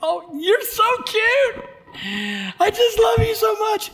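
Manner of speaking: put-on high, slow cooing at a baby; baby talk. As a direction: coo lovingly at the newborn. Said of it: "I'm looking at this baby and I'm like".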